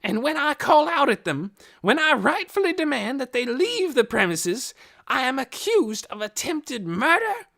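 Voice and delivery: loud, high indignant ranting; railing. A narrator's reading. Hoyt railing at Missus Beeson before the officers, indignant. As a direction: rant in rising indignation. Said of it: "Hoyt railed on".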